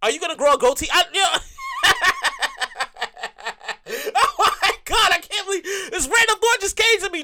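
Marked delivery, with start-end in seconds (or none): 1.4-4.1 squealing high laugh; high giggle. Real, not acted. Cracks himself up. 4.2-7.2 giggling, higher; laughing through words. Real, not acted. Laughing at own thought.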